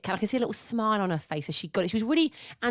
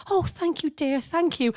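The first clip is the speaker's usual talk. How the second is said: put-on grateful sweet old lady; 7 semitones higher than usual. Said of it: "She was so grateful".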